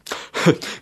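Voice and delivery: breathy creature chuckle; gryphon chuckle. A narrator's reading. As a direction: chuckle half to himself. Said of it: "gluckste er". (glucksendes Kichern)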